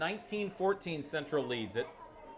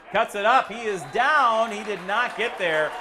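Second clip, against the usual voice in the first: excited play-by-play; 7 semitones higher, 7 dB louder than usual. Acted. Failed two-point try.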